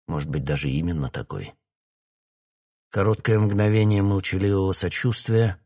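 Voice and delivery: ordinary delivery, nothing marked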